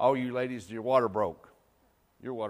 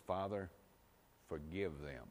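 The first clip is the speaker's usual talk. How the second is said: quiet tender prayer; 5 semitones lower, 12 dB quieter than usual. Retold or imitated. Jesus praying on the cross.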